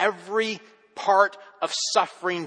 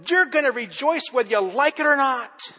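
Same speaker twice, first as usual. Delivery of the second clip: higher, louder mock threat; bully them into rejoicing; arm-twisting command; 10 semitones higher, 6 dB louder than usual. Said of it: "twist your arm saying".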